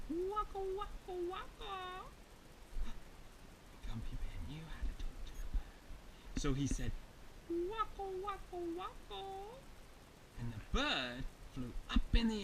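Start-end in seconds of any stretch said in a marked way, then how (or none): 0.1-1.7 soft, high, tiny bird voice; a hushed little high-pitched call; whispered bird call. Acted. 7.5-9.2 soft, high bird call; imitated bird whisper. Bear copies the bird's whispered call.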